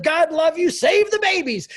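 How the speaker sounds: high, loud call out the car window; loud friendly shout. Retold or imitated. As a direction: yell it cheerfully to passersby.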